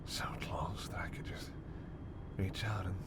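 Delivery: hushed predatory tease, sinking to a whisper; soft vampire temptation; seductive menace. Acted.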